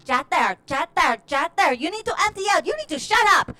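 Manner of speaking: building to a high, exasperated guru outburst; escalating mock scolding. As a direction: scold them, rising with each phrase. Guru scolds the chattering audience.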